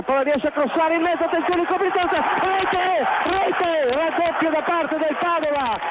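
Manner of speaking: excited goal call; goal shout. Real, not acted. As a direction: roar the goal.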